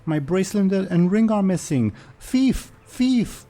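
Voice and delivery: panicked cry. Acted. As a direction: cry thief in a high panic. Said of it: "One of them is panicking".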